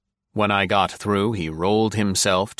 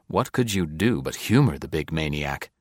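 plain reading voice

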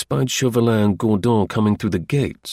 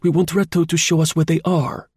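high, tart reprimand; some acerbity; 7 semitones higher than usual. A narrator's reading. Chauvelin blames Tournefort for last night's folly, with some acerbity. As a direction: lecture him acidly in a pitched-up voice. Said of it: "with some acerbity".

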